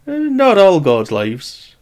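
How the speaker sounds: slow, high, knowing drawl; sly. Acted. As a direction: tease him with a sly doubt. Cyniscus sly objection.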